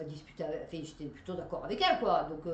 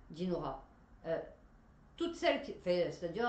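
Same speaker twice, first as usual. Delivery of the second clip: normal talking voice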